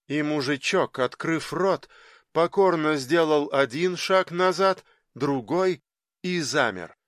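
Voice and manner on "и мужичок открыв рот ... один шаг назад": plain reading voice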